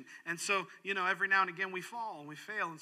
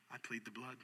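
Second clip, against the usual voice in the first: soft, low, reverent plea; a humble whisper of a plea; hushed plea; 7 semitones lower, 14 dB quieter than usual. Acted. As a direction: say it quietly, almost praying. Answering judge, hushed.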